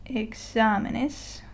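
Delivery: "Exámenes" drawn out slowly, stressed on XÁ; slow teacher's model of "Exámenes", leaning on the accented á; stress on accented syllable. Shown as a demonstration. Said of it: "tilde shows us where the accent is".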